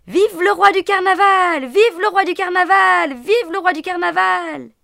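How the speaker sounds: high, loud chanted cheer; crowd cheering. Acted. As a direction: shout it like a cheering carnival crowd.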